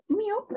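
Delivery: high rising meow; a coaxing little meow to call the cat; meowing up at the cat to coax it over; imitated meow.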